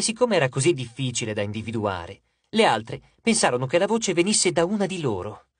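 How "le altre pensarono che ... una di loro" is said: ordinary delivery, nothing marked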